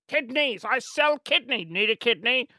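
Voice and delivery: hammed-up dodgy street vendor; shady seller. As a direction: peddle it like a shady trader.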